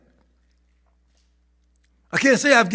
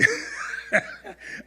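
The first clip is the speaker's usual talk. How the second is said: a sudden high airy laugh; quick wheezy giggle; high breathy laugh; 8 dB quieter than usual. Real, not acted. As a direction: burst out laughing under the breath. Laughing after telling RV story.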